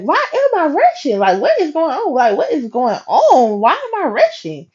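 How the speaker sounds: high-pitched, incredulous retold questions; exasperated self-questioning. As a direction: ask yourself in comic bafflement. Acts out questioning her own rushing.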